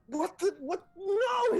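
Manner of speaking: high, loud outraged scream. Acted. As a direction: scream in disbelief, pitch shooting up. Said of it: "he starts just screaming".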